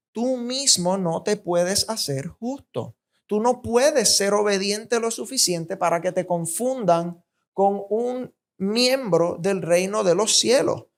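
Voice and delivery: usual speaking voice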